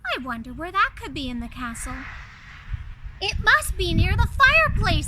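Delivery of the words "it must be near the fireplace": excited Chip. Acted. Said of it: "said Chip".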